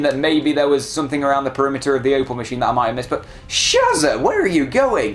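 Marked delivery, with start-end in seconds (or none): 3.5-5.1 high, drawn-out wail to a rival; high-pitched mock-distressed call; calling out in dismay. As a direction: call after them, high and plaintive.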